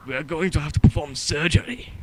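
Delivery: loud stern German doctor voice; thick German accent, booming and formal. Pretend. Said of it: "my German accent has improved".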